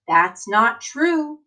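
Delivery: put-on slow, emphatic read-aloud for kids; reading to children. Teacher reading an encouraging book to her class, emphatic. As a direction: read it like a rousing picture book.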